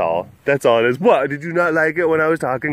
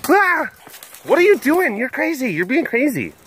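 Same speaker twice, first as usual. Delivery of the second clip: mock panic; 12 semitones higher than usual. Telling the pet it's being crazy, mock panic. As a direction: shriek in play alarm, easing down slightly.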